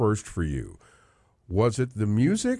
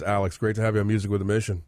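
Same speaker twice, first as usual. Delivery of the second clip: ordinary talk, nothing marked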